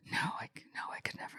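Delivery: tiny whispered refusal, acted out; timid whisper. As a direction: shrink back into a barely voiced whisper. Acting out timid refusal of invitation.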